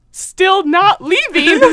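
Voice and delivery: slow, pointed comeback; exasperated retort. Retold or imitated. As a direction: stretch it out in disbelief. Acted exasperated reply to clerk.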